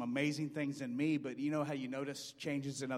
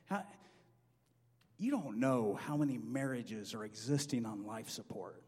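normal talking voice

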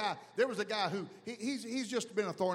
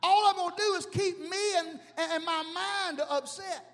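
higher, louder preacher's shout; full-force preaching; 9 semitones higher, 3 dB louder than usual. Acted. Pastor preaching to the congregation, shouting. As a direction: preach it hard to the crowd.

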